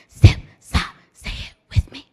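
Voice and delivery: whispered, a little stronger. Shown as a demonstration. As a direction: keep whispering, pushing the chant a bit. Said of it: "whisper it".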